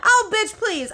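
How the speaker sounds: high, loud scoff; oh please, loud and high. Real, not acted. Scoffing at ex-friend.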